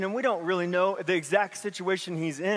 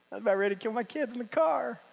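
honest aside; 4 semitones higher, faster than usual. Retold or imitated.

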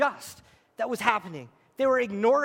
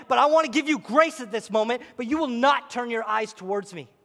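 usual speaking voice

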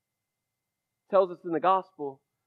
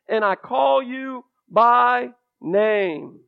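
loud and slow, building proclamation; preacher proclaiming Isaiah to the congregation, slow and loud; fervent scripture reading; 4 semitones higher, 5 dB louder, slower than usual. Acted. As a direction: proclaim the promise, stretching each phrase. Quoting Isaiah with fervor.

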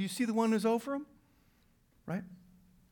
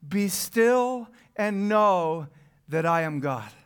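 very slow emphatic reading; slower than usual. Acted. Scripture read with weight.

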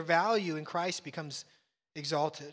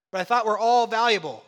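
mock child protest; 4 semitones higher, 11 dB louder than usual.

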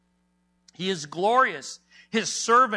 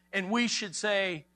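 usual speaking voice

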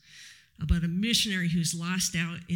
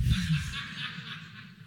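high laugh; 14 semitones higher than usual. Real, not acted. Laugh after story punchline.

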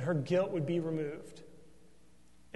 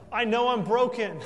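louder, higher cry of confession; voicing the psalmist's confession; 6 semitones higher, 9 dB louder than usual. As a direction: voice the psalmist owning up, full out. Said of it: "It's like the psalmist says".

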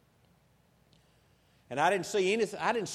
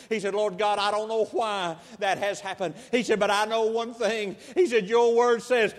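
louder and higher, building preacher cadence; preaching prayer; 5 semitones higher, 8 dB louder than usual. Acted. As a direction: pray with growing conviction and force. Preacher voicing father's prayer, building.

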